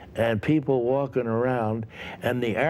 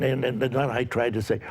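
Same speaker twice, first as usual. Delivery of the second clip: ordinary delivery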